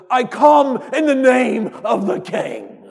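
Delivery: slow, high and loud heroic declaration; noble little mouse hero. Retold or imitated. Preacher voicing Reepicheep's famous line, slow heroic proclamation. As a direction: proclaim it like a tiny fearless knight. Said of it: "he does this famous line".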